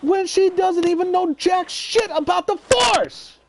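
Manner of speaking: real worked-up tirade; furious rant. Real, not acted. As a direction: vent your outrage at the filmmakers. Heated rant about the movie.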